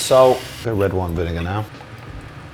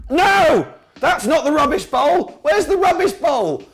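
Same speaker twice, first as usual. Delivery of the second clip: shouting in alarm. Real, not acted. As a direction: shout in panic at someone. Shouting at William over rubbish bowl.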